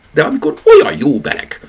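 a bunny defending the warm blanket; indignant protest. A narrator's reading. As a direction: protest as if wronged. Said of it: "méltatlankodott a nyuszi".

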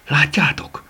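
high, carrying stage whisper; hushed, high-pitched question. A narrator's reading. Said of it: "suttogta Franci".

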